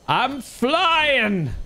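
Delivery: high, gleeful cry; excited. Real, not acted. Gleeful flight in game.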